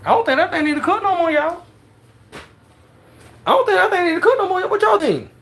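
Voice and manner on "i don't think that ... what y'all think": higher and faster, hyped repeat; excited. Real, not acted. Scene: cook hypes up viewers over the done meat, excited. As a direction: crank it up, excited about the meat. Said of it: "repeats the line louder and faster".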